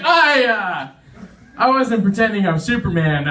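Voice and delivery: acting out being caught, hastily covering up; mock-flustered self-defence; flustered excuse. Caught posing in the bathroom.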